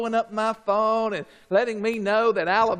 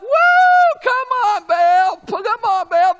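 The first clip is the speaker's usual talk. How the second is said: shouting, much higher hype-man whoops; frenzied cheering; 10 semitones higher, 9 dB louder than usual. Acted. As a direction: whip up a crowd for a false god. Frenzied prophets jumping up.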